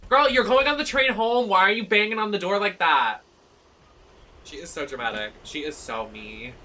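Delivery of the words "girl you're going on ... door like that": much higher, louder playful scold; loud mock scolding. Real, not acted. Gamer scolding her own character banging on the door. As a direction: tease your character loudly.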